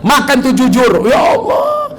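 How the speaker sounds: hammed-up furious wife yelling at her husband; nagging wife. Preacher mocks a wife scolding her honest husband, loud furious shout.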